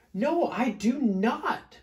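high, slow, loud denial; storybook growled refusal. A narrator's reading. Smelly bear arguing back, slow growly refusal. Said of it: "growled Big Smelly Bear".

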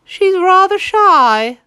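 high, loud, slow goose voice. A narrator's reading. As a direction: coo it like a coy goose. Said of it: "the goose replied".

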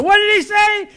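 high, loud blurt from a hard-of-hearing wife. Acted. Wife voiced in joke.

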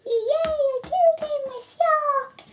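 high, fast triumphant squeal; excited cheer. Acted. Yoshi celebrates victory.

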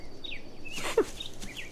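breathy high laugh; airy laugh. A narrator's reading. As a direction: let out a light airy laugh. Pippin laughs at Frodo.

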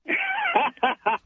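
a sudden, high, delighted laugh; high burst of laughter. Real, not acted. Caller laughing at his own punchline to the host, high delighted laugh. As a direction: crack up at your own story.

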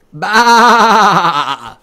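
loud, long wavering baa; sheep bleat. Acted. Animals answer Wilford in turn.

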